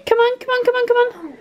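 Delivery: high, rushed come on, come on; urgent hurrying. Real, not acted. Mum hurrying the kids out the door, high and urgent.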